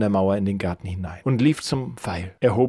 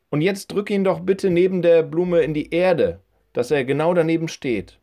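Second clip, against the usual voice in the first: higher lady's voice, directing; old woman instructing; 6 semitones higher than usual. Acted. Old woman has boy stake the flower, higher-pitched instructing voice. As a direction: guide the boy in a raised woman's voice.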